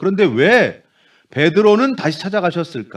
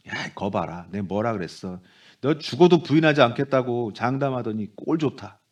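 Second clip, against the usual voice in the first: mock told-you-so; 7 semitones lower, 5 dB quieter than usual. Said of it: "뭐 이런 눈빛이었을까요?".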